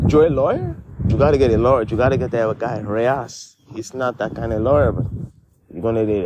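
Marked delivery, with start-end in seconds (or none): none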